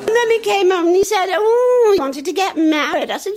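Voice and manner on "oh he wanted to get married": put-on high, loud child voice; excited little boy news.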